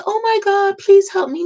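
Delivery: high, pleading little voice, mocking; mock sweet plea. Sneering at the idea of patients politely begging, in a high mock plea.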